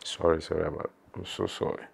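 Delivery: breaking down mid-interview, says sorry; emotional, quiet real apology; choked-up apology. Real, not acted. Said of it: "I'm being emotional because".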